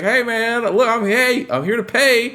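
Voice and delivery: squeaky mock panic to calm them down; nervous placating. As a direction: Bourbon pleading with the creditors, high nervous voice. Bourbon placating angry creditors.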